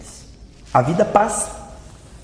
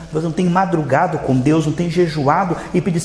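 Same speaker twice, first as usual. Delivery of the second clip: ordinary talk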